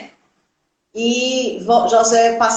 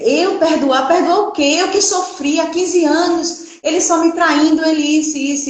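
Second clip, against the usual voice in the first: indignant protest; 5 semitones higher than usual. Retold or imitated. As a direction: protest that she is the one who suffered. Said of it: "E eu dizia". (protesto indignado, mais agudo)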